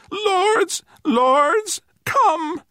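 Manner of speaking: very high, tiny creature voice; quavering plea. A narrator's reading. Little creature begging the man to follow, quavering.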